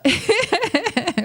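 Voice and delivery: high real giggle; laugh. Real, not acted. Host excited to share with listeners, laughing. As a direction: burst into high laughter mid-thought. Breaks into a laugh mid-thought.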